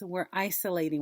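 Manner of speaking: ordinary talk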